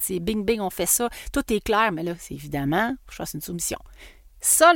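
normal talking voice

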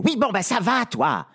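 a spoilt princess losing her temper; flaring up. Acted. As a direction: bite back impatiently at the servant. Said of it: "s'emporte la princesse".

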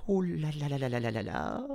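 fast, high panicked wail; little girl panicking at the tiger. A narrator's reading. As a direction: rattle it off in rising panic. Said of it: "se dit Minhee".

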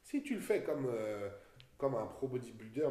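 ordinary delivery

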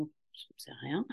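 soft unvoiced whisper, dropping away; whispered aside. Real, not acted. A practitioner admitting humbly to a colleague, whispered. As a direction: let it fall to a whisper, almost to yourself.

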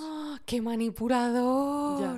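exclamación aguda, fuerte y alargada; indignación asombrada. Real, not acted. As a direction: suelta un "¡ah!" de asombro y alarga las palabras. Indignada reacción al relato del ex. (asombro indignado y escandalizado)

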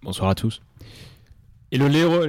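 ordinary delivery

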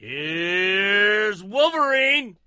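slow, drawn-out, loud announcer call; announcer intro. Acted. Co-host hyping the host's entrance, in a big drawn-out announcer call. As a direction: stretch it like a ring announcer.

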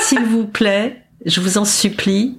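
hammed-up plea to keep her hair dry; mock pleading. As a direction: beg the nurse, drawing out each word. Pleading with the nurse.

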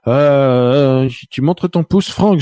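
teasing the guest who shows a thumbs-up; surprised call-out. Real, not acted. Host spots a thumbs-up.

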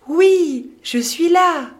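high, loud, bright shout; excited answer. Acted. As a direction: answer with delight. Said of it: "répond Hari".

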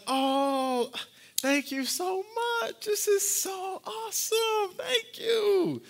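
very high, sing-song put-on thanks; mock fake gushing. Mother thanking dad for an unwanted gift, falsely gushing. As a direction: imitate a woman overdoing her gratitude. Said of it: "it just didn't seem authentic".

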